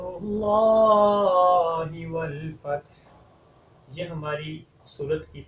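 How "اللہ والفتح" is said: long, slow sung recitation; Quran recitation. Acted. Teacher recites the first verse in tilawat. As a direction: stretch every syllable in tilawat.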